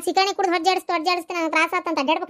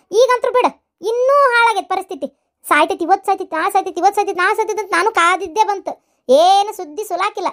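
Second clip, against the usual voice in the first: fed-up rant; 2 semitones higher, 5 dB louder than usual. Acted. Wife complains loudly about chores.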